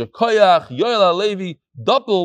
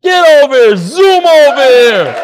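loud, stretched-out beckoning shout; loud calling out; 7 semitones higher, 10 dB louder, slower than usual. Real, not acted. Rabbi insisting the learner come up front, loud drawn-out call. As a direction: drag the last call out long and loud.

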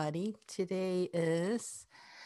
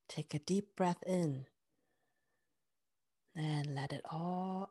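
guided meditation. Acted.

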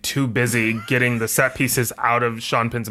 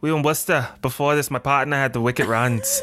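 put-on Boston accent. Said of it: "you sound like a combination between Mayor Quimby".

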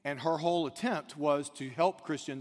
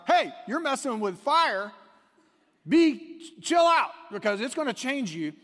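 high, loud alarmed warning; urgent warning shout; 9 semitones higher, 8 dB louder than usual. Acted. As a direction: shout it at her like she's playing with fire. Atheist friend's warning, acted out.

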